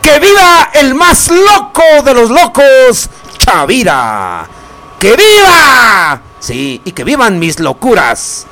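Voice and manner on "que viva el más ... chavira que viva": high, long drawn-out cheers; stretched festive cries, falling at the end; festive viva shouts. Acted. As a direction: call out toasts like a fiesta announcer. Radio host calling out vivas.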